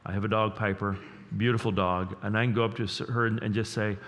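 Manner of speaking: usual speaking voice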